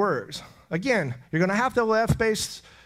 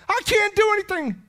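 much higher and louder, anguished; desperate cry; 6 dB louder than usual. Acted. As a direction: cry it out in helplessness. Voicing the listener's helpless reply.

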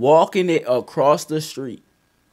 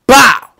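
loud mouth gunshot; loud imitated gunshot bang; 9 semitones higher, 13 dB louder than usual. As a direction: blurt a gunshot noise. Gunshot sound in story.

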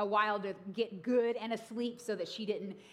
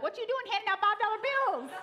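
sassy; 11 semitones higher, 4 dB louder than usual. Retold or imitated. Confronting husband in imagination.

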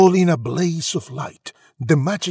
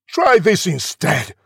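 loud, raised retort; yelled back; 5 semitones higher, 6 dB louder than usual. A narrator's reading. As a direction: yell back sharply. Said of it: "the prince yelled back".

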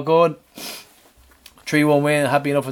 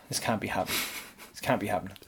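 mock despair; 6 semitones lower, 13 dB quieter, faster than usual. Disbelief at rental refusal.

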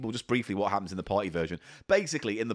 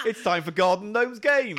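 game show announcer; 8 semitones higher, 3 dB louder than usual. Acted. Announcer intro.

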